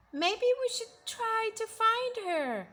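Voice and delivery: put-on high kids' voice; worried kids. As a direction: suggest it like anxious children. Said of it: "they said".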